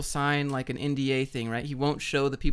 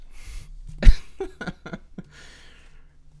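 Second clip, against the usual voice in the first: laughing; 4 semitones higher, 6 dB louder than usual. Real, not acted. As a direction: burst of real laughter. Laughs at own story.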